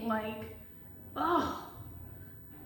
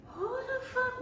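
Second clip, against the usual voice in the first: high, shocked who the fuck; baffled outburst; 11 semitones higher, 4 dB quieter, slower than usual. Retold or imitated. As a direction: retell a startled reaction. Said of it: "But I'm like".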